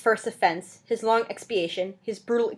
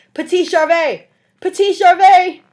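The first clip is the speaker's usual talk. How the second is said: loud, desperate calling out into the night; desolate call; 11 semitones higher, 14 dB louder, slower than usual. A narrator's reading. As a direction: call the boy's name with despair, louder and higher. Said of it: "in a most desolate and terrible voice".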